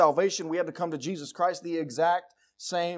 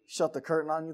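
normal talking voice

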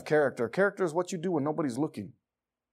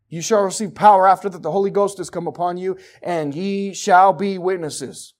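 raised, emphatic scripture preaching; loud, insistent pulpit delivery; forceful preaching; 5 semitones higher, 8 dB louder than usual. Real, not acted. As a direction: proclaim the verse to the room.

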